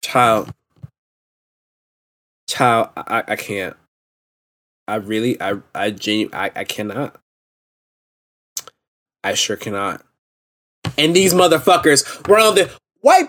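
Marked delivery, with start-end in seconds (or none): 0.0-10.0 low, soft and deflated; halting, worn-out near murmur; speechless disbelief. Real, not acted. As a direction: drop right down, too fed up to finish. Speechless after the outburst.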